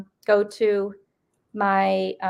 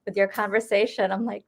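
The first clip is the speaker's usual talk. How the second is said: ordinary talk, nothing marked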